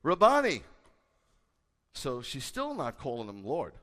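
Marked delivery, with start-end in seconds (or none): 0.0-0.6 "Rabboni" cried out higher and louder; Mary crying "Rabboni" to Jesus. Acted. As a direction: cry out in recognition. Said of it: "she cried out to him in Hebrew".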